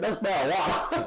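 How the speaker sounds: put-on light Tennessee accent. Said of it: "Tennessee accent".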